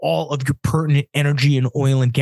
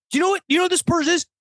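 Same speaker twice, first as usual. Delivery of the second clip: heated rant; 8 semitones higher than usual. Real, not acted. Ranting about policy analyst.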